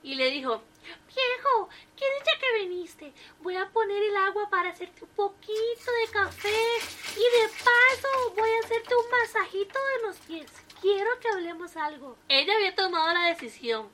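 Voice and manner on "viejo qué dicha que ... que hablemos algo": put-on high, sweet old wife voice; a cheerful elderly wife greeting her husband; lightly raised falsetto of an old lady; wife's voice. A narrator's reading. Wife voiced by male narrator.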